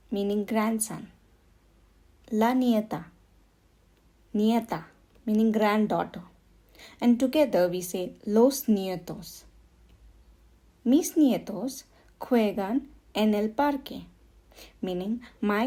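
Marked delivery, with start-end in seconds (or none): none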